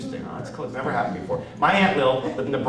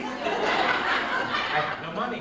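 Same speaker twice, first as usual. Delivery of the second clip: put-on zoned-out old woman, pitch lifted on a looping phrase; Aunt Lil; 5 semitones higher, 4 dB quieter, much slower than usual. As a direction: repeat it like a confused nursing-home patient. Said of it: "my Aunt Lil would sit there like this".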